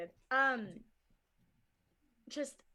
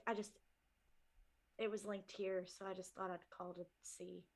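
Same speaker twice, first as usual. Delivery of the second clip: soft, hesitant, apologetic voice; shy nervous caller; 6 semitones lower, 8 dB quieter than usual. Acted. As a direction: mumble it timidly, trailing off. Plays shy character on phone.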